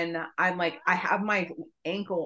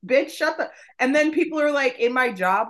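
high and loud, worked up; loud exasperated rant; 7 semitones higher, 6 dB louder than usual. Real, not acted. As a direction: rant at full pitch. Venting, rising.

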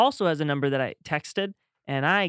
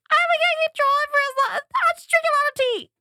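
very high, fast, louder squeak; high falsetto; 4 dB louder, faster than usual. Retold or imitated. Voice actor answering in a tiny falsetto, fast. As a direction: rattle it off in a tiny voice. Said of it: "how long can you control that".

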